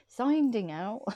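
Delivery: real slip into a West Country accent, "sending" as "soinding". Real, not acted. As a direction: let "sending" slide into a broad regional "soinding". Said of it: "My accent changes as well".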